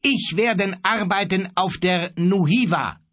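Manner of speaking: high-pitched, insistent retort; defiant. A narrator's reading. Defiant sailor answers the bellowing captain. (trotzig, mit erhobener Stimme)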